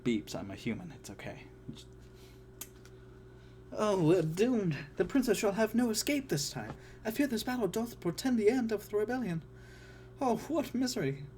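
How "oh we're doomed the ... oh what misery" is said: high, fussy robot voice in Shakespearean verse; a worried butler droid reciting iambic verse; C-3PO in Shakespeare. Acted. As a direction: declaim much higher like a panicky droid. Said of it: "enter C three P O".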